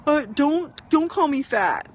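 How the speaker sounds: faltering acted plea; acted hurt reaction. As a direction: play the wounded victim, dragging the words. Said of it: "react and say".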